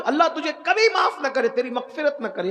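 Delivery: high-pitched, anguished condemnation; acted curse. Wronged girl cursing the man who violated her, impassioned. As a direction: cry out the curse with force. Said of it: "اس نے بد دعا دی".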